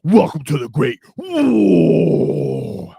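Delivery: slow, high, loud epic announcement; dramatic announcer. Pretend. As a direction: drawl it like a trailer voice. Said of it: "Sorry, I had to do it".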